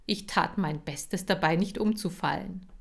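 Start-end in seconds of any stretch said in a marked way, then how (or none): none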